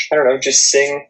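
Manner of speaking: ordinary talk, nothing marked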